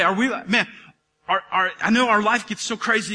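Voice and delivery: ordinary talk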